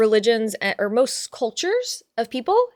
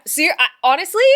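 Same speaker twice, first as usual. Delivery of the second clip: usual speaking voice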